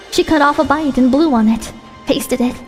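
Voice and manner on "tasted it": plain reading voice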